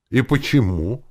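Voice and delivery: usual speaking voice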